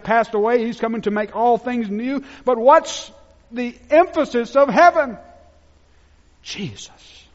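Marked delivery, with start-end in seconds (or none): 6.4-7.3 a reverent whispered answer; hushed whisper. Real, not acted. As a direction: breathe the name softly. Hushed reveal of emphasis.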